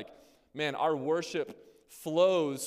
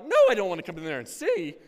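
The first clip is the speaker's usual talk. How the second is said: mock horror; 3 semitones higher, 8 dB louder than usual. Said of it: "And I'm like".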